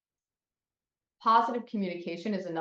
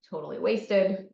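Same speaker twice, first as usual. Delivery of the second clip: ordinary delivery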